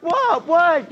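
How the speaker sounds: put-on high, innocent little-boy what; innocent kid voice. Boy faking innocence to his mother, high and sweet. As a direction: play it all sweet and innocent. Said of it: "I'm like".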